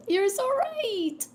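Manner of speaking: high, loud and slow; excited. Real, not acted. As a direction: squeal the agreement up high. High emphatic repeat.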